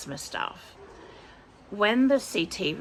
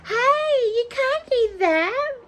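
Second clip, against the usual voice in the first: scolding pet; 8 dB louder, slower than usual. Real, not acted. Scolds pet mid-video.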